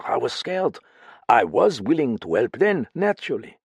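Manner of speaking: frightened foreign woman confiding in a friend; lightly French-tinged voice, uneasy and pleading; accented woman character. Acted. Same character voice.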